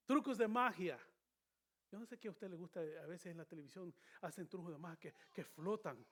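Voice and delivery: ordinary talk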